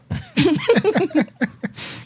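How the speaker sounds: high-pitched laugh; long high cackling laugh. Real, not acted. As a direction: burst of real laughter at a crude joke. Laughing at toilet remark.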